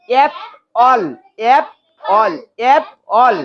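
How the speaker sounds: "অ্যাপল" in two separate beats; syllable by syllable. Shown as a demonstration. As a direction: say "অ্যাপ", pause, then "অল". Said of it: "syllable করে করে পড়ো".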